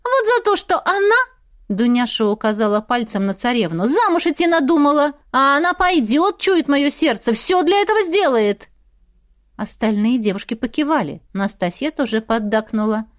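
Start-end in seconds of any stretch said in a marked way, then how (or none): 0.0-1.2 high, indignant accusation; accusing girl. A narrator's reading. Дуняша blaming the princess, accusing. 3.8-8.7 high-pitched grievance; accusing girl. A narrator's reading. Дуняша blaming the princess for wanting marriage, indignant.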